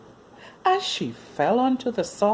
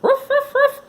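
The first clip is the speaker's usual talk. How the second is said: puppy barks; 8 dB louder than usual. Acted. Puppy barking.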